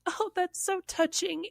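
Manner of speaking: soft, high-pitched mock tenderness; misty-eyed gushing. Coworker touched in a women's circle, misty-eyed. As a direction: imitate someone sweetly moved, small and high. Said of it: "misty-eyed".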